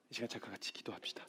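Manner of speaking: hushed invitation to pray; whisper. Real, not acted. Pastor whispering to the congregation before prayer, hushed.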